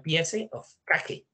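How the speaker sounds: "piece of cake" read as Spanish, "pie-se of ka-ke" with every E sounded, slowly; Spanish-style reading. Shown as a demonstration. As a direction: read "piece of cake" letter by letter like Spanish, final E's pronounced. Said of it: "si yo lo leo como en español".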